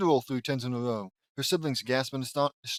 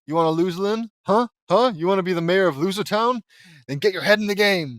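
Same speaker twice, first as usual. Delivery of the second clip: loud, fired-up berating; aggressive self-pep-talk; 5 semitones higher, 6 dB louder than usual. Acted. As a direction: chew yourself out like a tough coach. Furious pep talk to herself.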